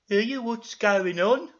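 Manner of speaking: loud, slow, high character voice; nosy question drawn out high; Ermintrude. Acted. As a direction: put on a loud rustic lady. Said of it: "said Ermintrude".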